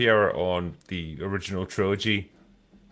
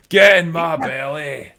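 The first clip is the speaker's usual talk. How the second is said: higher, slowed, laughing Fat Bastard voice; Fat Bastard impression; 6 semitones higher, 4 dB louder, slower than usual. Podcaster laughing, imitating Fat Bastard for friends. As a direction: laugh through a greedy Fat Bastard growl. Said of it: "I can do Fat Bastard from Austin Powers".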